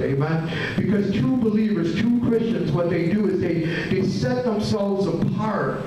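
ordinary talk

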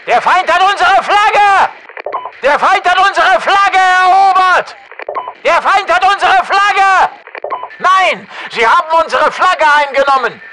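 high, loud battle yell; high-pitched military alarm shout. Acted. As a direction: urgently alert the team. Shouted alarm over taken flag.

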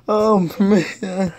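ordinary talk

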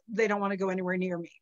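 usual speaking voice

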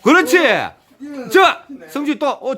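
ordinary talk

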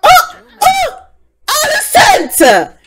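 high, loud gasping cry of the name; shocked outcry. Real, not acted. Viewer yelps at Alicent's slap in shock. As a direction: yelp in disbelief at the screen.

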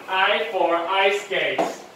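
slow, drawn-out model phrase; teacher's slow repeat-after-me delivery. Shown as a demonstration. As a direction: space each word out for learners. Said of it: "repeat after me".

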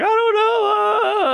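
put-on crying girlfriend. Girlfriend sobbing to the dad, wailing. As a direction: wail it through tears. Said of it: "And she's like".

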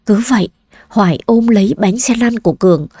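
ordinary delivery

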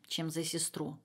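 ordinary delivery, nothing marked

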